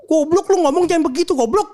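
hammed-up telling-off, breaking into a laugh; wife scolding. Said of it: "gua ngomong gini".

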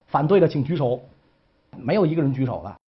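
ordinary talk, nothing marked

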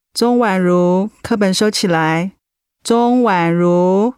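her name dragged out very slowly as a warning; stern call. Acted. Teacher calling a distracted student. (老师慢慢拉长叫名字，带着警告)

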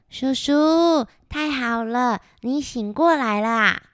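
a hint of a well-mannered kid greeting uncle; polite little grasshopper. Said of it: "小蝗虫很有礼貌地说". (有礼貌的小蝗虫轻声说话)

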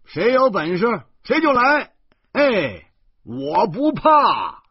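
high, loud and slow bravado; defiant shout. A narrator's reading. Walking through the dark hills, shouting to prove he isn't scared. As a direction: yell it to pump up your courage. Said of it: "一边喊着呢".

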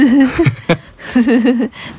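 a loud, bursting laugh. Real, not acted. Wife laughing loudly at her husband's denial.

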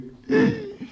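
a sharp high gasp, mimicking a hanged ghost; a choking ghost's gasp, imitated; mock gasp. 鬼现吊死的可怕样子. (模仿吊死鬼的倒抽气)

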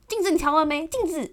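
loud, sharp scolding from the passenger seat; harsh bark. Retold or imitated. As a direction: snap at her like a furious backseat driver. Boyfriend barking at her in car.

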